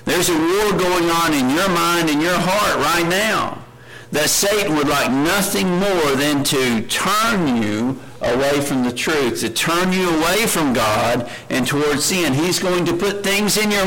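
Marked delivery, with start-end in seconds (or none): none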